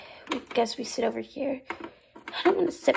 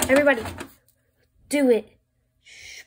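ordinary talk, nothing marked

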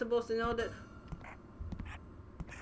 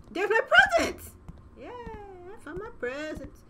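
excited find; 8 semitones higher than usual. Real, not acted. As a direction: burst out thrilled at the find. Finds presents in game.

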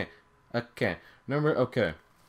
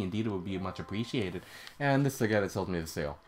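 lower, softer storytelling voice, lightly put on; merchant telling his tale; 9 semitones lower, 6 dB quieter than usual. Sail seller telling of his shipwreck, lightly voiced merchant. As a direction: recount it low and soft, lightly in character.

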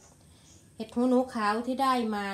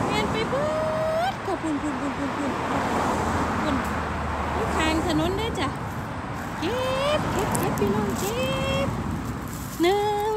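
excited; 9 semitones higher, 8 dB louder than usual. Real, not acted. Spots mushrooms by the road, thrilled.

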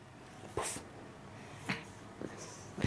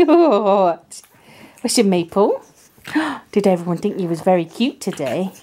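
ordinary talk, nothing marked

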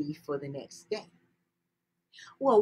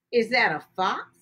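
plain reading voice

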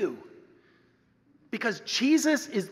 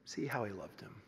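hushed; 11 semitones lower, 12 dB quieter than usual. Acted. Quoting the mourners, hushed.

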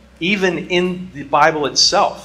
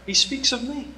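Jesus voice; 12 semitones higher, 4 dB quieter than usual. Retold or imitated. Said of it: "he goes".

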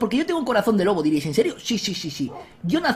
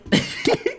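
breathy high-pitched laugh; airy giggle at the enemy; 12 semitones higher than usual. Real, not acted. Gamer cackling at a confused opponent, high giggle.

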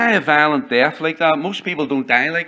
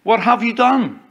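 slow, then higher and louder accusing question; God confronting Cain; 6 semitones higher, 5 dB louder, slower than usual. Acted. God questioning Cain over his brother's blood, accusing. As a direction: demand it as God asking Cain. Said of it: "when God approached Cain, he said".

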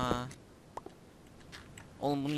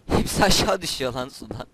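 loud alarmed shout; 11 dB louder than usual. Real, not acted. Items falling, shouting in alarm. (telaşla bağırarak)

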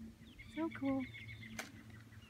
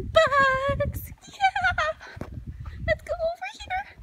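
squeaky high, slow and eager; excited high voice; much slower than usual. Real, not acted. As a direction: hype up the bug hunt. Excited kid-show call.

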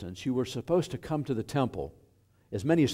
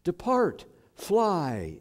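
raised-pitch call, understated shout; mild shouted command; 7 semitones higher, much slower than usual. Acted. Jesus sending the clay sparrows away, mildly shouted command. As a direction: call it out, pitched up, without full force. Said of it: "shouted to the sparrows".

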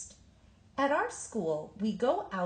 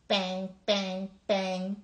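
punchy, a bit louder bang, bang, bang; thumping kicks at frozen teeter-totters; banging sound words; 3 dB louder, slower than usual. A narrator's reading. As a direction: hit each bang like a kick.

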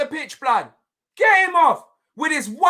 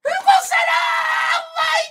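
rising into a high, excited shriek; gloating shout; 10 semitones higher than usual. Real, not acted. Fan gloating over a sending-off, excited shouting. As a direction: crow it, climbing higher with each repeat.

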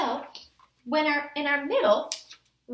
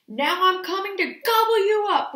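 loud, raised roaring threat; troll roar; 4 semitones higher, 7 dB louder than usual. A narrator's reading. Troll threatening the big goat, roared. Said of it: "roared the troll".

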